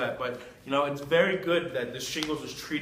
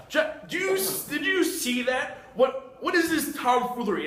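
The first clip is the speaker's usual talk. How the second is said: outraged rant; 2 semitones higher than usual. Acted. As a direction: rant like a scandalised host. Host rants at the clown customer.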